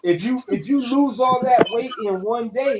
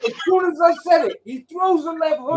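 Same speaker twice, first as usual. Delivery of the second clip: high, raised-voice blow-by-blow; hyped fight recap, pitched up; excited retelling; 8 semitones higher than usual. Real, not acted. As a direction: burst out with the big moment.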